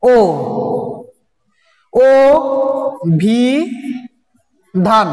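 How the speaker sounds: ও ভি ধান as separate syllables with long pauses; syllable-by-syllable demo. Shown as a demonstration. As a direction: sound out ও, then ভি, then ধান slowly. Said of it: "প্রথমটা কি".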